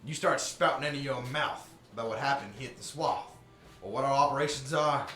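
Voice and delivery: loud, taunting mob enforcer; menacing gang boss. Acted. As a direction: threaten the kids with a grin. GM voices gang NPC threat.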